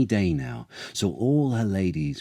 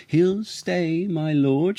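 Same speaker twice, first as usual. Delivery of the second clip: high, bright and loud, a queen crowing victory; triumphant cry; 11 semitones higher, 3 dB louder, slower than usual. A narrator's reading. Queen announcing her win to the king, high triumphant cry. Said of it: "she cried triumphantly".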